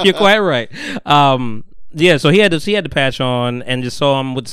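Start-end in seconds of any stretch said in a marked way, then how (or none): 0.0-0.7 mock-posh line broken by laughter; half-laughing posh echo. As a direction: say it while cracking up.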